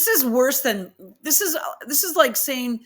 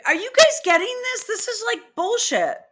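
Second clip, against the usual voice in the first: exasperated outburst; 10 semitones higher than usual. Real, not acted. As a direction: rattle it off in disbelief. Outraged at the text.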